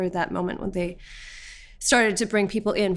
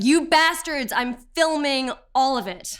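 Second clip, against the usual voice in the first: raised, high mock yell; retold shout; 6 semitones higher than usual. Detainee retells yelling at police while filming, raised retold shout. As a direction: call it out indignantly, acting out the shout. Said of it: "I was shouting".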